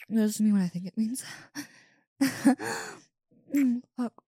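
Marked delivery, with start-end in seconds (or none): 2.2-2.9 high giddy giggle. Acted. Giddy joy.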